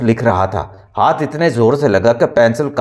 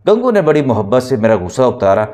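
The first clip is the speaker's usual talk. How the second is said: usual speaking voice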